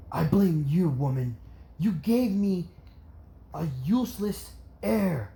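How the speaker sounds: angry blame. Acted. Father blames Inko for the son, angry accusation. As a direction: throw the blame at her.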